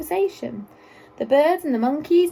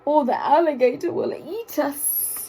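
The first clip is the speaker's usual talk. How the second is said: hissing snake; 4 semitones higher than usual. A narrator's reading. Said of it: "hissed the snake".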